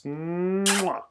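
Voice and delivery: long hummed mwah; drawn-out, high smacking kiss; big kiss sound. A narrator's reading. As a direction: plant a noisy, exaggerated smooch.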